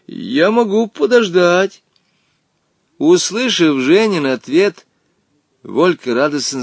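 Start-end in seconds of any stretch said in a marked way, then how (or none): 0.1-1.8 brave. Acted. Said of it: "мужественно ответил Женя".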